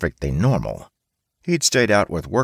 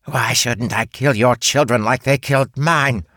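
loud, high spider threat; menacing threat; 7 semitones higher, 4 dB louder than usual. Acted. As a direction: threaten with venom.

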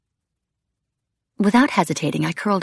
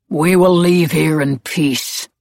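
loud, slow, weighty declaration; deliberate, tense character voice; guarded Blaze; 6 dB louder, slower than usual. A narrator's reading. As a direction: state terms to an enemy. Cornered Blaze answers the witches.

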